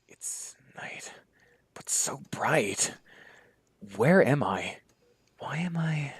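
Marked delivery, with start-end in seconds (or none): 0.1-2.9 whispered slowly, in wonder; awed whisper. Acted. Waking confused in strange room. 5.4-6.1 puzzled whisper. Acted. Waking confused in strange room.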